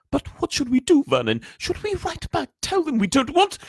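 very high, fretful voice; anxious aunt. A narrator's reading. Aunt asking husband what to do, high and anxious. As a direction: fret helplessly at your husband.

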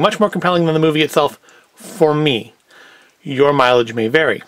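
normal talking voice

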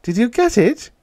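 high-pitched, eager question; excited. A narrator's reading. Irene asking Peter about the money, excited. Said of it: "Irene asked excitedly".

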